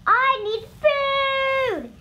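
slow, high, loud monster wail; hungry cry. A narrator's reading. Monster's tummy rumbles as it begs for food, crying out. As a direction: stretch out a ravenous demand. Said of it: "it cried".

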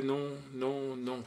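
understated small voice saying no; small child's refusal. A narrator's reading. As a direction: refuse quietly, one 'no' after another. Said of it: "la petite voix répondait".

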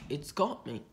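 ordinary delivery

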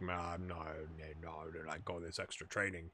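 lightly voiced grumbler, low and quiet; mock unwilling player. Manager imitating player refusing extra training, low grumble. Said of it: "and he was like".

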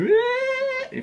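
very high-pitched imitated crying squeal; mock breakdown wail. Fan told he's too busy, mock breakdown wail. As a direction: squeal like someone bursting into tears. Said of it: "full on breaking down".

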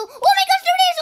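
loud, high-pitched alarm; alarmed shout. Real, not acted. Gamer spotting the tornado, high alarmed shout. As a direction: yell out in sudden shock.

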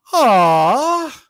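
high, loud whiny letdown; hammed-up fan disappointment; mock disappointment. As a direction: whine like a let-down fan. Imitating disappointed listeners.

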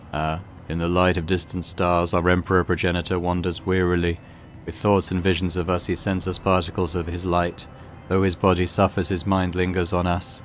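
low monotone chant; monotonous muttering. Acted. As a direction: recite it evenly on one low note, like a muttered prayer. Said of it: "the hooded man mutters monotonous, monotonously, but emphatically".

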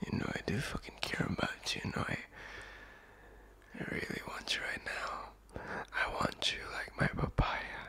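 teasing lover's whisper; seductive whisper. Acted. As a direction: whisper it close and flirty. Seductive whispered confession roleplay.

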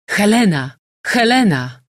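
high, loud calls of a name; calling out. A narrator's reading. General setting the big dog after Helena, calling out. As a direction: urge the dog on with a shout.

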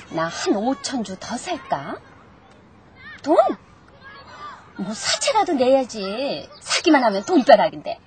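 ordinary talk, nothing marked

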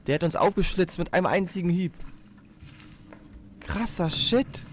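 high, loud shaken gamer talk; scared horror game streamer. Real, not acted. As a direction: still rattled after the scare. Aftermath of monster attack.

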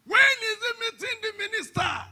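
high, loud mocking voice; mock incredulous question. Preacher mocking the man's appointment, high and loud. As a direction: ham up the asker's disbelief.

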